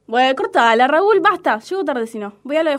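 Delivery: loud, fed-up snap at husband; annoyed. Acted. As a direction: shut him down, exasperated. Discusión con el marido. (esposa harta, fuerte)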